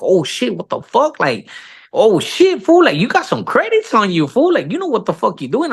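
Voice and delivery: high amazed exclamations; shocked. Retold or imitated. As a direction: react floored, pitch flying up. Director shocked at IMDb credits.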